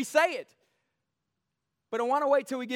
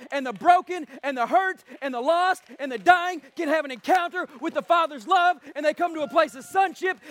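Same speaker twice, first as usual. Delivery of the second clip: high, impassioned sermon delivery; forceful preaching; 8 semitones higher, 4 dB louder than usual. Real, not acted. Preacher pleading for the sick and the lost, impassioned preaching. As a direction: build a relentless list to a loud declaration.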